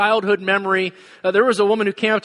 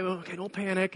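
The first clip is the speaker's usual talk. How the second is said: self-calming; 12 dB quieter than usual. Retold or imitated. Wife calming herself.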